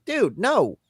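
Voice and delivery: ordinary talk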